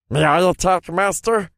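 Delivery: high, loud creature voice; eager familiar. Acted. Familiar creature begs its master.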